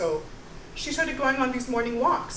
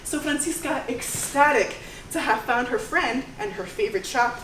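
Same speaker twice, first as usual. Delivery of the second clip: ordinary talk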